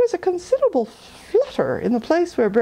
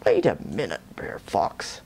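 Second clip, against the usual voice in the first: lower, a grumbling bear voice; 14 semitones lower, 3 dB quieter than usual. Acted. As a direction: grumble it low and sulky like a big bear. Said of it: "Brer Bear sort of grumble".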